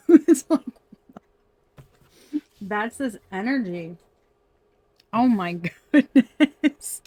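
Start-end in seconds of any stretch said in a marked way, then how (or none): 0.1-0.6 laughing out loud mid-sentence; can't hold in the giggles; bursting laugh. Real, not acted. Tarot reader cracking up at a funny vision, laughing. 5.1-7.0 slow, louder, laughing as she speaks; words broken by real laughter; laughing through it. Real, not acted. Reader to viewers, losing it, laughing out loud. Laughing fit during reading.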